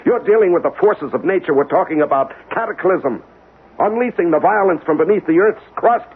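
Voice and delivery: high, agitated pleading about coming disaster; frantic scientist begging the colonel to stop the tests; urgent warning. Acted. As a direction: plead with rising panic, voice pitched high.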